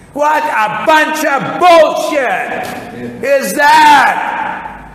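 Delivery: slow, loud, high scornful outburst; outraged shout. Real, not acted. Preacher scoffing at the guru's excuse, drawn-out outraged shout. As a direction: bellow it in disbelief.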